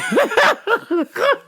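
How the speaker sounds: loud, high laugh; high cackle. Real, not acted. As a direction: crack up at yourself. Laughing at own joke.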